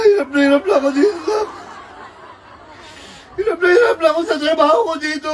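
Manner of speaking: acted wailing complaint; tearful lament. Parent tells child the work is too hard, tearful. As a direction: cry through the words. Overworked parent breaks down on call.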